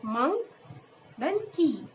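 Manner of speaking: "monk", a pause, "then key", each syllable drawn out; syllables shown separately. Shown as a demonstration. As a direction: set "monk" and "key" apart as two beats. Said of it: "when we pronunciate monkey".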